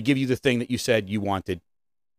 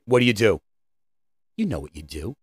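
ordinary delivery

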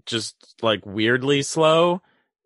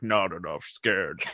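much lower, soft, slow put-on voice; mock creepy killer; 7 semitones lower, 7 dB quieter than usual. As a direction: scoff it slowly and low. Voicing Buffalo Bill scoff.

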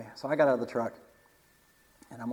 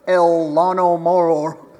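slow, louder, put-on Spanish accent; mock Spanish; 2 semitones higher, 10 dB louder, slower than usual. Joking about buying gas in Mexico, slow mock Spanish accent. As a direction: roll it out like a local in Mexico.

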